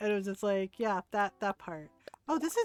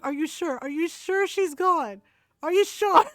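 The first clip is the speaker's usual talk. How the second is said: higher, louder nervous pleading; scared whisper-like ask; 5 semitones higher, 5 dB louder than usual. Retold or imitated. Brother on the floor asks if mom left, scared. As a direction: beg to know it's safe.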